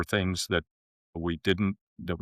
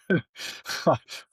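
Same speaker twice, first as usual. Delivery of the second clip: amused laugh; 9 semitones higher than usual. Real, not acted. Laughing at the irony.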